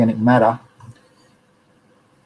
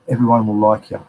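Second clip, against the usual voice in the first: ordinary talk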